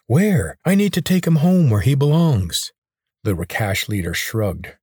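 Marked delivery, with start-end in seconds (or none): 0.1-2.7 insistent. Acted. Corvin demands his father.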